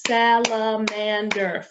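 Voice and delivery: salamander stretched out slowly syllable by syllable; teacher sounding out salamander for the class; syllables pulled apart. Shown as a demonstration. Teacher counting syllables aloud, slow and segmented. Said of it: "how many syllables are in salamander".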